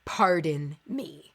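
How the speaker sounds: a solemn, slow "Pardon me"; slow, grave warning. Shown as a demonstration. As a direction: drag out "Pardon me" as a forbidden phrase. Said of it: "never say".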